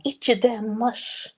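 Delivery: mock daycare worker snarling at kids to eat; mean daycare worker. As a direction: drag out the insult like a nasty babysitter. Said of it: "she'd be like".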